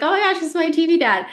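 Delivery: high, fast excited gush; retold starstruck excitement. Acting out thrilled first meeting.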